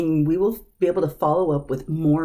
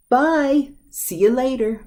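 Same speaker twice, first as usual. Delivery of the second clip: high, louder bye, then see you later; cheerful goodbye; 7 semitones higher, 4 dB louder than usual. Shown as a demonstration. Teacher demonstrating a cheerful goodbye. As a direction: wave someone off brightly. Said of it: "These are both used".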